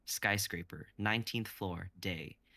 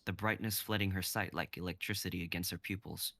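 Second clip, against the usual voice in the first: normal talking voice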